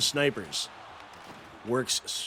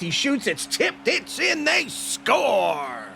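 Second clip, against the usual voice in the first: rising, louder, higher goal call building to a shout; excited goal call; 12 semitones higher, 4 dB louder, much slower than usual. Real, not acted. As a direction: call the goal as it goes in, climbing to a yell.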